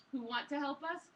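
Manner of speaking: usual speaking voice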